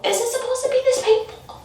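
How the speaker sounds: high, quick, distressed question; imitating her own squeaky-high alarm; pained pleading. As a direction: plead with the technician mid-pain. Retold pained question to technician.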